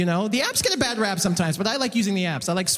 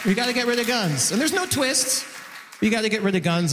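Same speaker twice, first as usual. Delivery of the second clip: ordinary delivery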